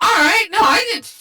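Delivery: loud, high protest; defensive shout. Real, not acted. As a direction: cry out in defence. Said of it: "that, that shut you down".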